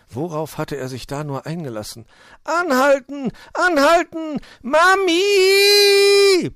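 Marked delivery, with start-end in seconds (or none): 2.4-6.5 sehr hohes, panisches Geschrei; brüll in Todesangst, das letzte Wort langgezogen; Brüllen. A narrator's reading. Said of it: "brüllte er". (panisch brüllen)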